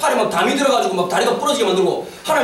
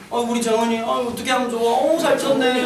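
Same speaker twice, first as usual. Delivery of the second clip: hammed-up tender comforting, pitched down; mock soothing. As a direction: coax as if calming a sulky child. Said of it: "그럼 정말 달래야 돼요".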